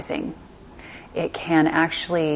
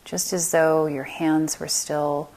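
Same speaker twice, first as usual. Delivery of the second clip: unhurried meditation voice, long silences between phrases; guided meditation. Acted. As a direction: lead them calmly through the body, letting phrases settle.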